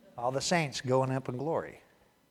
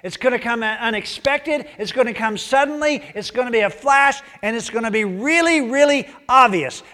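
higher, louder urgent warning; Jesus telling his followers, urgent and raised; 10 semitones higher, 12 dB louder than usual. Retold or imitated. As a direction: drive home that it's coming. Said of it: "he goes".

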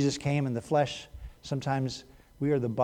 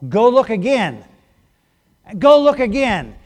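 insistent command; 6 semitones higher, 8 dB louder than usual. A narrator's reading. Elijah urging servant repeatedly.

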